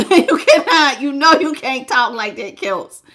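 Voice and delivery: can't keep a straight face while scolding; amused, breaking into laughter; laughing scolding. Real, not acted. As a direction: laugh through a half-hearted telling-off. Cracking up at viewer's crude comment.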